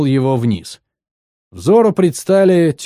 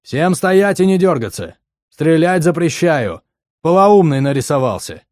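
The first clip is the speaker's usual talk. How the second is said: urgent raised command, higher pitched; 5 semitones higher, 4 dB louder than usual. A narrator's reading. Mercenary leader commanding his men to hold fire, urgent. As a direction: order everyone to freeze, slowing for emphasis. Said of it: "Своим парням он скомандовал".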